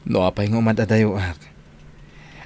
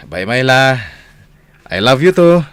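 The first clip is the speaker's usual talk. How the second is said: louder and higher, calling his love down the phone; loud loving goodbye; 4 semitones higher, 8 dB louder than usual. Acted. Man calling goodbye to his faraway girlfriend, loud and loving. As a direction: say it big so she hears every word.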